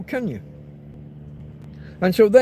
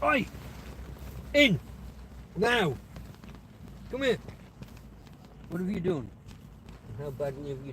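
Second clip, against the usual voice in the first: sharp commands at a dog; shouting at dog; 11 dB quieter than usual. Real, not acted. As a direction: bark the orders at the dog.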